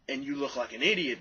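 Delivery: ordinary talk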